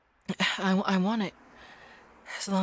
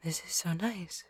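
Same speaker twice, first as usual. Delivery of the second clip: soft, close whisper in a hug; breathy, tender murmur; whispered while hugging; 2 semitones higher, 10 dB quieter than usual. Acted. Girlfriend whispering into the listener's ear during a hug.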